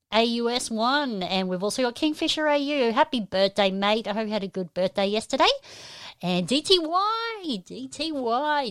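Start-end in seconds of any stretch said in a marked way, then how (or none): 6.2-8.7 high, drawn-out letters; playful shout-out. Real, not acted. Host hailing a viewer in the chat, playful drawn-out call. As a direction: stretch each final sound out high, then repeat.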